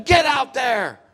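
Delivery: high, loud sideline shout; coach's yell. Retold or imitated. Coach sending a confused player in, imitated yell. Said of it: "the coach just yelled at him".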